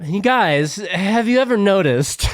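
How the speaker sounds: hammy observational comic; mock stand-up opener. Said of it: "I'm so bad with stand-up".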